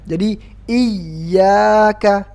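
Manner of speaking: "iyyaka" slow with weight on the doubled ya; stressed doubled ya. Shown as a demonstration. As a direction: lean on the ya of "iyyaka". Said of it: "ingat diayunkan".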